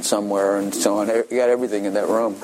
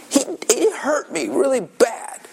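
put-on whining little girl. Daughter complains again.

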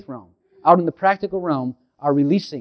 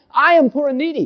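David crying out; 11 semitones higher than usual. Retold or imitated. King David crying out in the Psalms, high plea. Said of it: "David cried out in the Psalms".

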